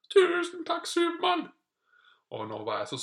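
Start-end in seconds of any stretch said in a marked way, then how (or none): 0.1-1.5 very high, loud grateful squeal; hammed-up shrill thanks; Dronning Sonja. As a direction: gush as a comic high-voiced queen. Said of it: "greide faktisk dronning Sonja å si".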